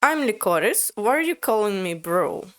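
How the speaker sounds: ordinary talk, nothing marked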